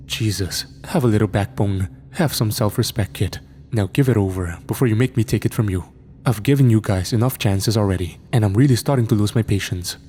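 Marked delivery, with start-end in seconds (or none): none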